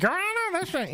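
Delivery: very high squeaky courtroom voice; high lawyer voice. Retold or imitated. Recalling his lawyer role on stage, very high. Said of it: "and then I'm like".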